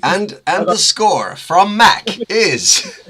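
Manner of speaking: mock song contest results voice; Eurovision jury announcer. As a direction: draw it out like reading the scores. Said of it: "let's go to Poland".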